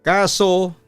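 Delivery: usual speaking voice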